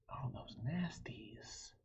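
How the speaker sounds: usual speaking voice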